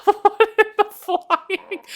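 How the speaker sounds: stunned by what just appeared; baffled. Real, not acted.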